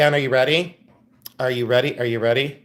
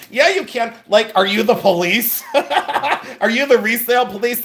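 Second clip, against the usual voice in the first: higher, laughing retort; laughing defiance; 6 semitones higher than usual. Real, not acted. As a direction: laugh off the critics while answering them. Laughing defiance at resale critics.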